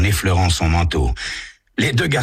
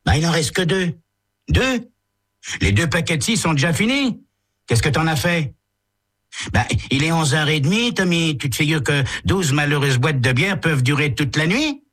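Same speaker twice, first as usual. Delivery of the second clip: put-on higher, swaggering boy's voice; cocky teen Tommy; 12 semitones higher than usual. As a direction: brush off your buddy, sure of yourself. Teens bicker over beer.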